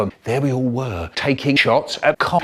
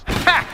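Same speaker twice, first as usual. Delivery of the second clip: triumphant ha; 13 semitones higher than usual. A narrator's reading. Crushing police with plane.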